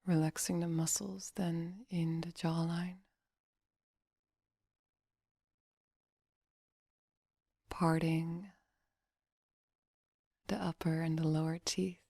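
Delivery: soft, unhurried meditation voice; guided meditation. Acted. Meditation coach relaxing listeners' face and jaw, soft guiding voice. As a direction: guide them gently into relaxation, leaving long pauses.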